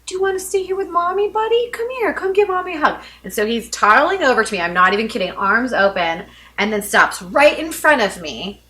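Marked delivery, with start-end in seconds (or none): 0.1-3.0 put-on high-pitched coaxing baby talk; singsong mother, much higher pitched; mommy voice. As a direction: coax a toddler over for a hug. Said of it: "And I said".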